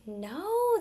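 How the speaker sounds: usual speaking voice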